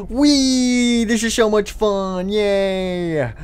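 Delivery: gasp, then high drawn-out whee; gleeful. Acted. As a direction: squeal with joy on a ride. Child enjoys go-kart ride.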